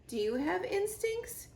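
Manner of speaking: high, wondering gosling voice; gosling asks the robot, high and curious. Acted. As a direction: ask it with childlike wonder.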